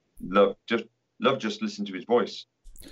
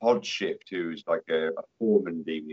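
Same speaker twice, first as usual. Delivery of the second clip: usual speaking voice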